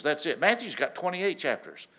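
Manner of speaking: ordinary delivery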